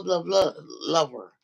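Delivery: ordinary talk, nothing marked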